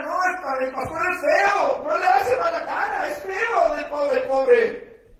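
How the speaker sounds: high, loud playful shout about the ugly pastor; joking shout. Real, not acted. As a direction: shout the joke out for laughs. Broma sobre el pastor feo. (grito en broma, agudo y fuerte, sobre el pastor feo)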